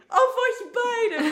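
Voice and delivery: high, loud, very drawn-out cry of pride; gushing exclamation. Retold or imitated. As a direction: imitate an overjoyed friend, laughing through it. Friend's gushing answer acted out. (überschwänglich gejubelt, nachgeahmt)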